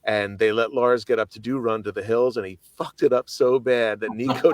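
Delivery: ordinary delivery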